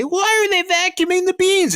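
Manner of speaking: put-on very high bratty kid question; whiny kid voice. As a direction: squeak it like a puzzled child. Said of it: "and the kids say".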